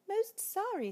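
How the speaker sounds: very high squeaky apology; polite mouse. Acted. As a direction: apologise in a tiny voice. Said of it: "said the mouse".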